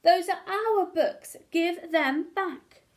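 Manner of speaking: loud, slow, high cry of outrage; children yelling. A narrator's reading. Children shouting at the book thief, loud and slow. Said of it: "and the children yelled".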